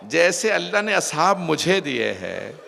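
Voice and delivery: loud, slow, impassioned lament; retold tearful cry. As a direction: cry out to a grandfather in mourning. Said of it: "और रो के कहा".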